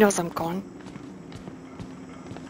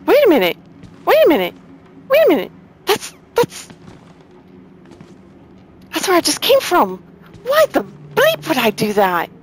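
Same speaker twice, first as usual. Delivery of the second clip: loud, climbing much higher each time; alarmed outburst; 9 semitones higher, 6 dB louder than usual. Real, not acted. Player realising he's back where he started, alarmed high shout. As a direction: shout in disbelief, pitch shooting up.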